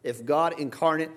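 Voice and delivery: high, loud preaching at full force; fiery preaching. Acted. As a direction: preach it out to the congregation.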